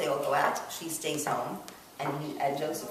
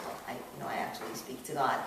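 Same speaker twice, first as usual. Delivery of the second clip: nearly whispered, soft admission; hushed confession; 4 semitones lower, 6 dB quieter than usual. Retold or imitated. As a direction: confide it under your breath. Joseph answers master shyly.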